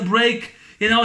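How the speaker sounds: normal talking voice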